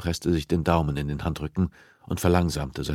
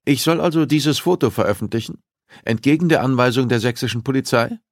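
higher, louder worried editor; editor Ulbricht, raised; 8 semitones higher, 6 dB louder than usual. Acted. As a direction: push back, voice up and tense. Ulbricht protests, narrated dialogue.

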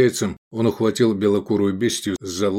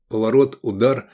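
plain reading voice

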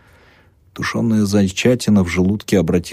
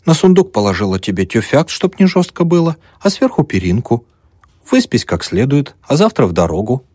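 put-on high, loud, bustling old peasant woman; fussing old woman; 7 semitones higher, 4 dB louder than usual. Old mother bustling over the student's bed. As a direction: flutter about the guest, all eager to please.

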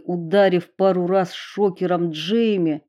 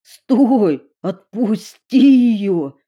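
loud trembling cry, slowed; shaky shout; 3 semitones higher, 7 dB louder, much slower than usual. A narrator's reading. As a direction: call out with a trembling voice. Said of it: "дрожащим голосом крикнул Эрик".

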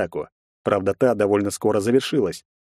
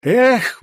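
loud high exhale with a swing; effort grunt; 14 semitones higher, 6 dB louder than usual. A narrator's reading. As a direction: throw it out with the bottle swing. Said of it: "выдохнул противник". (выдох с усилием)

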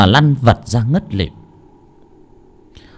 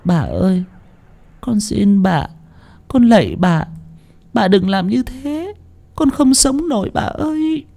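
high, wailing plea climbing higher; tearful pleading; 10 semitones higher than usual. A narrator's reading. Servant girl begging her mistress, sobbing plea. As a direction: beg in a high, crying voice. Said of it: "con Tân đang khóc nức nở van xin bà Phú".

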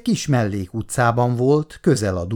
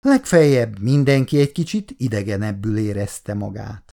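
ordinary delivery

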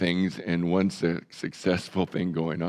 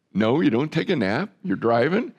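ordinary talk, nothing marked

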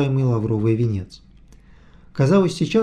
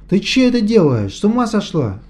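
furious chef; 7 semitones higher, 4 dB louder than usual. A narrator's reading. Vityukha scolding the cook.

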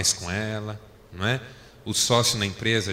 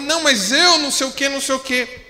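retold heated reply; 13 semitones higher, 6 dB louder than usual. Wife's side of retold quarrel.

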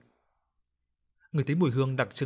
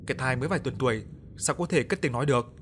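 usual speaking voice

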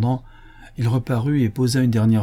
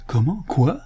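high, rising questions; astonished exclamation; 6 semitones higher than usual. A narrator's reading. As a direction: officials crying out in disbelief. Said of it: "s'écrièrent les fonctionnaires d'une seule voix". (exclamation stupéfaite)

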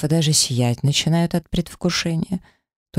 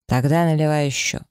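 plain reading voice

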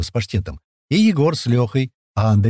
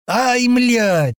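high-pitched cry of pain; startled yell; 13 semitones higher than usual. Acted. Паштет suddenly yells from behind a bush, startled. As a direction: yelp the curse in alarm. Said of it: "Неожиданно заорал на весь лес".

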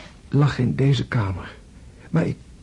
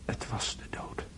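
awed whisper; 8 dB quieter, slower than usual. Acted. André telling dream of death.

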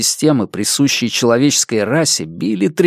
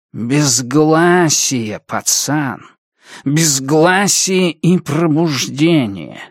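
very slow, drawn-out proclamation; фанатичный коротышка; 3 semitones higher, much slower than usual. Acted. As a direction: intone it like a crazed prophet. (медленно, протяжно, как безумный пророк)

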